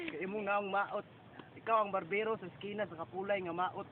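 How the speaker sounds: ordinary talk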